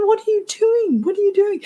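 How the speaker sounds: high, startled double question as if catching someone out; baffled. Retold or imitated. Coach baffled by her client's sudden posh voice, asking high and startled.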